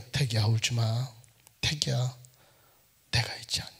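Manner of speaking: low soft comforting voice of God; God's gentle voice. Retold or imitated. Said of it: "갑자기". (태기를 달래는 하나님의 낮고 부드러운 음성)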